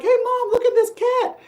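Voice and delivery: put-on very high, loud kid's voice showing off a cat; excited child. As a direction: call out to mom like a proud little kid. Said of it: "I'm like".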